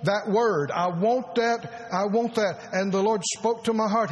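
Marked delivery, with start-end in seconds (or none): 0.0-2.7 slow longing plea. Retold or imitated. Pleading for a word from God.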